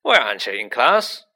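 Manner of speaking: loud question with a swooping rise; Hagrid. A narrator's reading. Hagrid questioning Harry in the corridor, loud and booming. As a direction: boom the question out.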